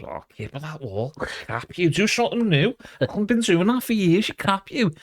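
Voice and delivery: lightly hammed-up taunt; mock heckler. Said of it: "someone comes up and just goes".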